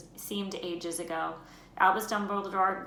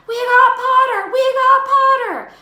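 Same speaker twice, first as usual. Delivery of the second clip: much higher and much louder, slow triumphant yell; twins yelling; 17 dB louder, slower than usual. Acted. The Weasley twins cheering as Harry joins their table, yelling. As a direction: cheer it at the top of your voice. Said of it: "the Weasley twins yelled".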